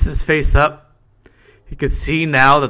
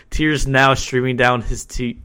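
usual speaking voice

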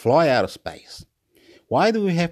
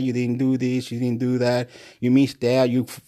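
ordinary delivery

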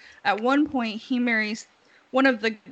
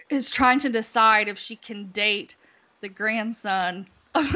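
usual speaking voice

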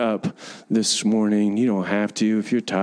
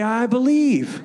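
declaring; 9 semitones higher than usual. Shown as a demonstration. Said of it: "Say, say".

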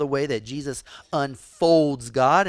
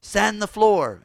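higher and louder barked order; commanding Miyagi; 8 semitones higher, 7 dB louder than usual. Retold or imitated. Preacher voicing Miyagi ordering Daniel mid-fight, loud and high. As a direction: call it out as he throws a punch. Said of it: "And he said".

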